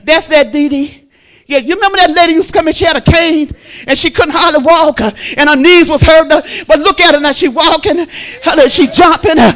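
loud, high sermon voice; shouted gospel preaching with a rising pitch; full-force preaching. Acted. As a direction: preach it to the rafters. Preaching the healing story.

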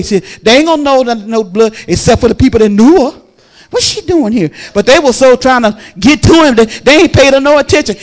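loud, driving preacher's cadence; preaching at full force. Acted. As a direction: preach it to the whole church. Preacher retells the woman's story.

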